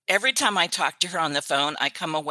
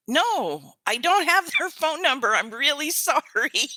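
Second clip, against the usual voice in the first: high, bright mock apology to a fan asking for contacts, Southern-free; a high, apologetic no, half laughing; 9 semitones higher than usual.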